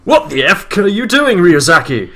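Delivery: shouted. Acted. Lightstad yelling at Ryuzaki, high and loud. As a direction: yell the question at him. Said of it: "shouted Lightstad".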